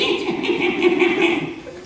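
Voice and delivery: high breathy mock group cackle; hammed-up goblin gang laughter; cronies laughing. Goblin cronies jeering at the dwarf, laughing high. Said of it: "His cronies laughed".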